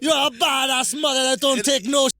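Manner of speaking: bad Cool Runnings Jamaican accent. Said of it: "That was the worst Jamaican accent I ever heard".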